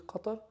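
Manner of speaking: Qatar said the Arabic way, guttural q. Real, not acted.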